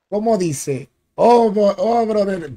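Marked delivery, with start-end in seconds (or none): none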